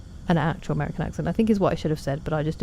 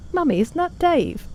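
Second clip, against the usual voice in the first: much higher, imitated little-kid question; child to mum; 11 semitones higher, 4 dB louder than usual. Retelling asking mum about her friend on screen, imitated child's voice. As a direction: pipe up high, imitating yourself asking Mummy. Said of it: "And then I literally, about ten minutes later, was like".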